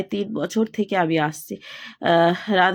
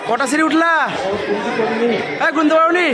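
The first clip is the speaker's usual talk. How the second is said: raised calling voice; 6 semitones higher than usual. Real, not acted. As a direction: call out across the steps. Calling up to family.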